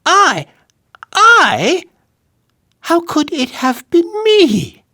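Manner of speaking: high, innocent protest; dwarf denying to the king; startled dwarf. A narrator's reading. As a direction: feign surprise. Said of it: "merely ejaculated".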